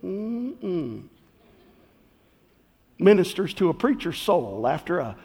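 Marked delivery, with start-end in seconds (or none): 0.0-1.0 savoring hum. Acted. Savoring the finished pie.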